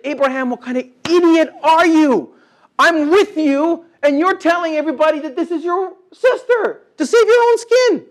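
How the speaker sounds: high, loud, exasperated rebuke; hammed-up divine telling-off; mock scolding God. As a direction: scold like an angry father catching a lie. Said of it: "God coming down and say".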